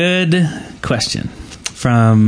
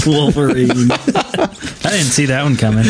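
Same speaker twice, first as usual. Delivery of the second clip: laughing. Real, not acted. Laughing at Wolverine joke.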